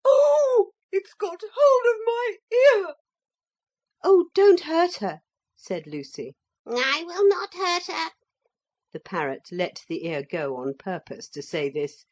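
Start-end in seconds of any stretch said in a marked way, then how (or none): 0.0-2.9 very high loud pained cry; scream. A narrator's reading. Motor lady as the parrot grips her ear, screaming. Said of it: "And then she screamed". 4.0-5.2 high, loud anxious plea; pleading. A narrator's reading. Lucy to the parrot, anxious plea. 6.7-8.1 high, calm promise; lightly reassuring parrot. A narrator's reading. Parrot to Lucy, mild reassurance.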